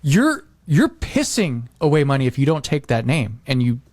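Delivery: usual speaking voice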